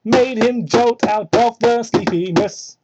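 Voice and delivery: normal talking voice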